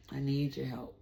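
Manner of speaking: low, soft retold plea to God; a hushed, imploring prayer; quiet prayer. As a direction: pray softly, pausing between phrases.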